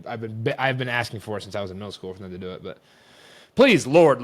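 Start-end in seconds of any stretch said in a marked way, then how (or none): 3.5-4.2 loud, high exasperated prayer; desperate plea. Real, not acted. Fed-up fan praying for a firing, desperate plea. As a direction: beg heaven loudly, pitch jumping. Begging for coach to be fired.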